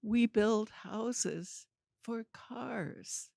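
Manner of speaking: slow, spelled-out restatement; patient, stretched-out explanation; slow, deliberate insistence. Retold or imitated. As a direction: spell it out slowly for someone who didn't get it. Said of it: "every time I said it, it was more ridiculous".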